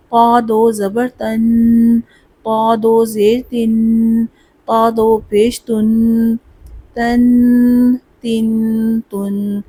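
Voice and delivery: slow, spelled-out drill for students to repeat; slow drill recitation. Shown as a demonstration. Teacher leading a spelling drill, slow. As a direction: chant each syllable slowly. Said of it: "تا پور پڑھا جاتا ہے".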